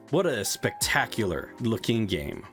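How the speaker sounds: ordinary talk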